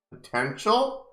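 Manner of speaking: high, rising critical question. A narrator's reading. Mom questioning the feedback on her daughter, critical. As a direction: query it critically, pitched right up. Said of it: "Mom asks critically".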